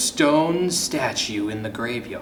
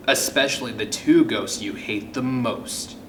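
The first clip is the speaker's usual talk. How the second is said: plain reading voice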